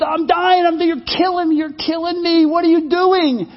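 high-pitched, hammed-up panic; mock screaming. Cat crushed under rolling horse, mock screaming. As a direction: cry out as if being crushed, playfully overdone. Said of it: "and he's screaming".

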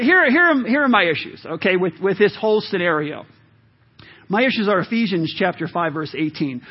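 usual speaking voice

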